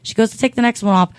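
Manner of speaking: normal talking voice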